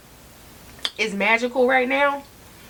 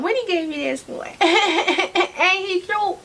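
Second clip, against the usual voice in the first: giggling, slow and higher; laughing; 6 semitones higher, slower than usual. Real, not acted. Vlogger showing her friend's gift, giggling. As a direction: coo over it while laughing.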